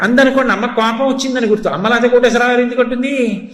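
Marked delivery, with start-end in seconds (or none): none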